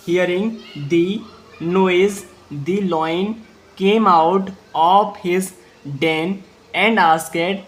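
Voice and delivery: slow, word by word English dictation; slow read-aloud. Shown as a demonstration. Teacher reading slowly for the class to repeat. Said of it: "आपको मेरे साथ repeat करना होगा".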